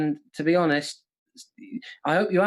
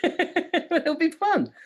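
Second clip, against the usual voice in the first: drawn-out sing-song; 12 semitones higher, much slower than usual. Pretend. Teasing about the quote round.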